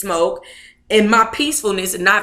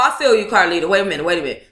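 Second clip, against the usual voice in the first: ordinary delivery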